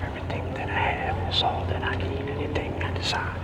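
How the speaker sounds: soft, breathless whisper of self-affirmations; hushed chant calling in energy. Acted. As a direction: murmur each mantra like a private prayer.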